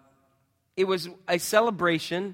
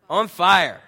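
louder, punched, higher declaration; emphatic loud answer; 4 semitones higher, 12 dB louder than usual. Acted. Pastor to congregation, answering what En Fuego means, loud and emphatic. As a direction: announce the series title like a rallying cry.

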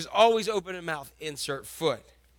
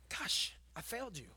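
remorseful sigh; 18 dB quieter than usual. Retold or imitated. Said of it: "Simon Peter was like".

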